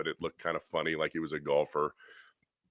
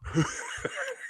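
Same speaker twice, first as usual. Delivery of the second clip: high breathy laugh; 5 dB quieter than usual. Real, not acted. Laughing at candy insult.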